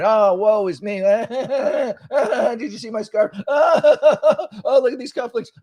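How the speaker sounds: high, laughing fake whimper of a whiny mayor; mock crying mayor. Mocking the mayor's whining, high and laughing. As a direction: whine like a spoiled crybaby.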